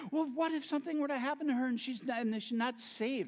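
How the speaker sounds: high, fast mock-worried wife; rushed fretting, voiced high; anxious wife. Ex-wife's fearful worry quoted.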